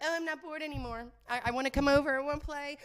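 lightly hammed-up child voice; whiny kid. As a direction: put on a kid pestering a parent. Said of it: "and they're like".

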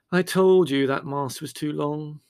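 unctuous. A narrator's reading. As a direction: draw it out with oily self-satisfaction. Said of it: "said Larry unctuously".

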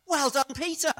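high, loud mock congratulation; big, emphatic well-done; imagined hearty approval. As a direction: praise him with exaggerated delight. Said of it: "He wants Jesus to say".

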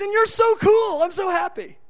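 God inviting a believer to ask, delighted and high. Acted. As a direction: gush with joy at the asking. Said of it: "He goes".

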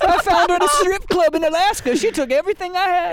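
put-on high, creaky old-timer voice; old prospector. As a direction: spin a yarn like a grizzled miner. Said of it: "That's a good voice".

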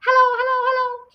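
high, loud squawky call; loud, clear parrot. Acted. Hidden parrot heard across the hall, loud and high. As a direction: sing out from another room in parrot voice. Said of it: "came the voice again".

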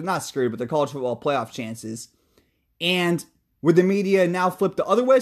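ordinary delivery

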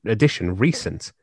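ordinary talk

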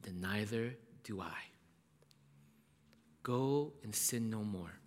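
Jesus whispering forgiveness to the woman; whispered mercy. Acted. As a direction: breathe the forgiveness gently. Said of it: "And then he says".

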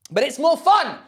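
high, loud gleeful outburst; giddy, rising exclamation; manic glee. Real, not acted. Owns up to losing his mind.

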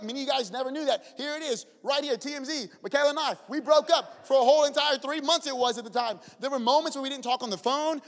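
preaching. Real, not acted. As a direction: drive the testimony home to the church. Preaching the testimony with force.

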